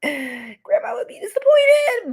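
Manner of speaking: mock lament. To the chat about grandma, a hammed-up wail. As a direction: ham up the guilt like a tragedy.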